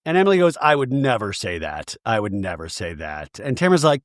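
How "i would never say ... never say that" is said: low voice, sinking softer on the repeat; mock solemn denial. Emily denying the Spanx remark, mock solemn. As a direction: deny it in a deep, dropping put-on voice.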